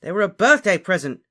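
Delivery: loud, high, defensive yell; shouting. A narrator's reading. Dangling Ron answers Harry's demand, shouting. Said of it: "shouted Ron".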